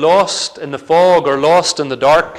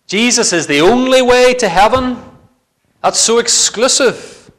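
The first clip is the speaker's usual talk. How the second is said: high, loud, indignant imitation of a critic; mock objection; 7 semitones higher, 4 dB louder than usual. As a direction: play the scandalised objector. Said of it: "some to object and say".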